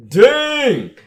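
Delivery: long, high, very loud holler; huge awestruck outburst; real excited shout. Real, not acted. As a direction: yell it out in amazement, stretching the word. Amazed reaction to the reveal.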